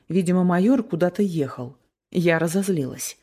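usual speaking voice

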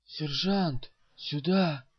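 forceful hissed call to a sergeant; urgent hiss. A narrator's reading. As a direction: hiss the summons, urgent and strained. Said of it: "шипит мне Мел". (настойчивое шипение)

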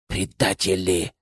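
hissed. A narrator's reading. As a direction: hiss it after them. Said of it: "прошипел им вслед Акира".

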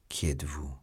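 low, soft, stunned question; petrified. A narrator's reading. Petrified Felton first questions Milady, stunned and hushed. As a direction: ask it under the breath, as if frozen. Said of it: "comme pétrifié".

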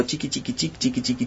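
quick, quiet little chiqui sounds; soft stitching sound. A narrator's reading. Narrator mimicking the spider's stitching, hushed. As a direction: murmur the sewing noise like tiny stitches.

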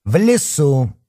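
firmly. Acted. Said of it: "жёстко повторила Оксана".

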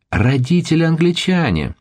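shrill high squeal of disbelief; high shriek. A narrator's reading. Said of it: "взвизгнула девчонка так пронзительно". (пронзительный визг)

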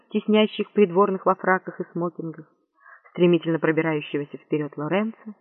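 ordinary delivery

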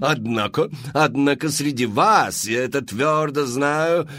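high, loud, theatrical madame pitching to the crowd; showy saleswoman. Acted. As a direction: sell it like a grand Parisian hostess. Мадам Фру-Фру. (высокий громкий голос мадам-зазывалы)

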